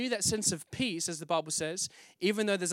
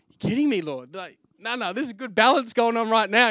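loud incredulous protest to God; protesting loudly; 3 semitones higher, 9 dB louder than usual. Retold or imitated. Protesting aloud to the Lord about quitting. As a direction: push back, loud and disbelieving.

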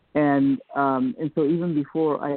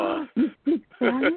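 high, startled "oh, you speak Spanish?"; mock surprise; 11 semitones higher than usual. Shocked strangers realizing she understood them, high mock surprise. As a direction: play the shocked stranger, pitched way up. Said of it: "they would be like".

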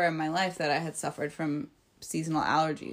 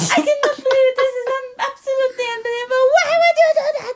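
loud, very high mock-mother tirade; outraged mom; 7 dB louder, faster than usual. Mother scolding her kid over marijuana, outraged high-pitched mock shriek. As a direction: build into a hammed-up shrieking scolding. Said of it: "She still talks to me like this".

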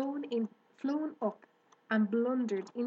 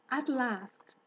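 ordinary delivery, nothing marked

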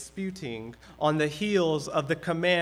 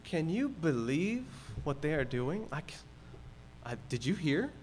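gossiping murmur; 3 semitones lower, 9 dB quieter than usual. Retold or imitated. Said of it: "we call gossip".

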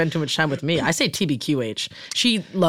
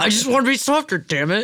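high, hammed-up imitation; teasing high-voiced mock plea; mock frustrated outburst; 9 semitones higher, 3 dB louder than usual. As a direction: playfully mimic with pitched-up exasperation. Mimics the other's line, mock outburst.

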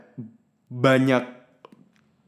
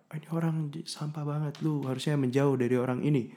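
mock judgmental muttering; 3 semitones higher, 8 dB quieter than usual. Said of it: "instead of kayak lu judge them".

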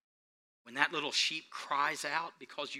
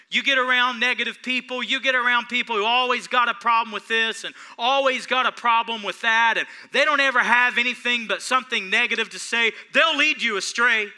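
louder, higher preaching push; preaching at full force; 8 semitones higher, 10 dB louder than usual. Acted. Preacher warning the congregation about bad friends, full-force preaching. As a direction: build it like a pulpit warning. Warns against negative people.

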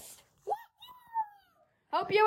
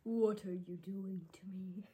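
female voice filter; 8 semitones lower, 20 dB quieter than usual. Pretend. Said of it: "your voice modification was female".

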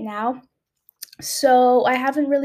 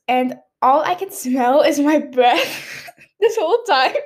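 giggling through the words; laughing while talking. Real, not acted. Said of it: "I don't know why I'm laughing".

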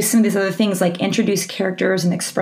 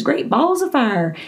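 put-on southern US accent; 4 semitones higher, slower than usual. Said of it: "goose has a southern, a southern accent".

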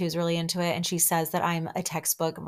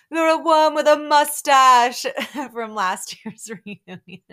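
laughing through it; 7 semitones higher, 9 dB louder than usual. Real, not acted. Recalling the mustache flashback.

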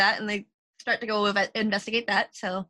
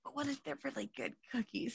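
much softer, sad pleading pup; 15 dB quieter than usual. Acted. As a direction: plead softly and sadly. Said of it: "softly and sadly".

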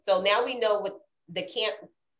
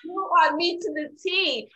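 excited; 9 semitones higher, 4 dB louder than usual. Real, not acted. Still excited after recognition.

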